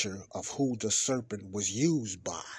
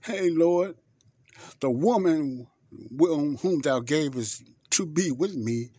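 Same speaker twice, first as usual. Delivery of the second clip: higher, slowed-down excuse to the Lord; Adam making excuses to God, higher and pleading; 5 semitones higher, 4 dB louder, slower than usual. Retold or imitated. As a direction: plead like a caught man passing the blame. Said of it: "Listen to what Adam said".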